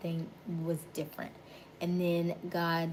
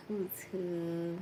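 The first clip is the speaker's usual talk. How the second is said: normal talking voice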